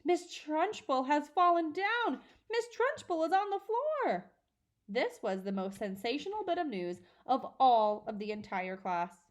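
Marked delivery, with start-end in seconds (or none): 0.1-4.3 very high, loud shrieking alarm; screaming. A narrator's reading. Wilfred screams that Trunchbull fell, high and loud. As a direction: shriek the news to the class.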